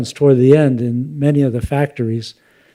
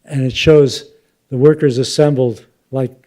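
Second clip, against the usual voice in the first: ordinary delivery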